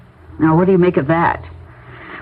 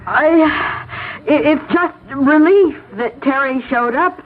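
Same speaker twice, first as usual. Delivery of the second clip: tearful relief; 7 semitones higher, slower than usual. Acted. May shaken, claims relief.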